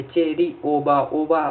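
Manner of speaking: Cherry ओबा said slowly, each part apart; slow dictation of cherry ओबा; slow spelled-out term. Shown as a demonstration. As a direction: draw out cherry ओबा for the students to note. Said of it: "ओबा मतलब कि".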